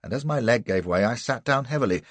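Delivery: ordinary delivery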